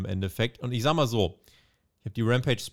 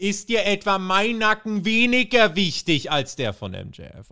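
slow, higher mock complaint; lightly voiced aggrieved wrestler; complaining; 7 semitones higher, 4 dB louder than usual. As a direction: whine like a hurt friend. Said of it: "der Strong beschwert sich".

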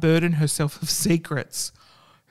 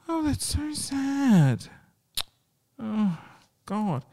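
gasp of sorrow; 9 semitones higher, much slower than usual. Real, not acted. Touched by the ending.

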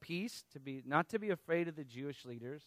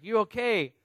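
louder, higher, more insistent; calling out to someone panicking; 7 semitones higher, 10 dB louder than usual. Retold or imitated. Man to curator still freaking out, insistent raised voice. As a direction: raise it to get through to them. Guy repeats it to panicking woman.

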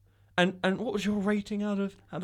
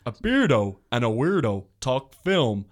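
slow, loud trailer announcer; drawn-out booming movie voice; 3 semitones lower, 5 dB louder, much slower than usual. Pretend. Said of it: "should I do my movie voice?".

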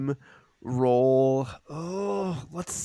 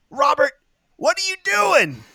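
startled call; 7 dB louder than usual. Acted. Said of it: "he goes".

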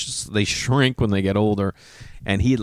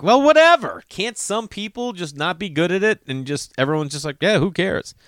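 dismissive; 8 semitones higher than usual. Retold or imitated. To himself about being bad at names, high shrugging whatever. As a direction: toss it off like nobody cares. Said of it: "I'm just wanna be like".

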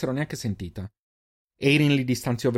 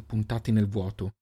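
plain reading voice

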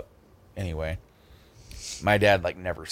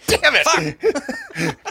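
cursing while breaking into laughter, much higher; laughing curse; 7 dB louder, much slower than usual. Retold or imitated. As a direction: laugh through a mock curse. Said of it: "I'm like".

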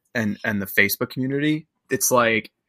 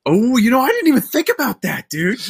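higher, mock amazed; sarcastic surprise; 10 semitones higher, 4 dB louder than usual. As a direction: play it like a fake revelation. Sarcastic realization.

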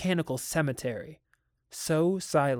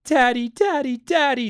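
high, loud, happy singsong; singsong children; 10 semitones higher, 8 dB louder than usual. A narrator's reading. Children thanking father for relenting, singsong. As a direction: sing it out like delighted children. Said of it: "sang the children".

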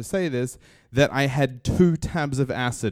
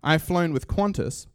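ordinary talk, nothing marked

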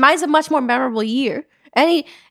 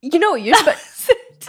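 high, slow laughing retort; 4 semitones higher, much slower than usual. Real, not acted. Firing back at her friend, high and laughing. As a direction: cut off by giggles.

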